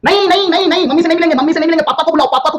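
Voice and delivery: high-pitched, insistent principal refusing; mock insistent refusal. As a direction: play a principal who won't hear of it. Principal refusing mother, demanding father. (ज़िद भरा ऊँचा इनकार, नकल में)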